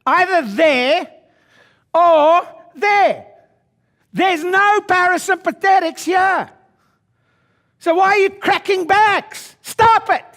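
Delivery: roaring sermon cry; fiery shout. Real, not acted. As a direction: preach it at full force. Fiery preaching peak.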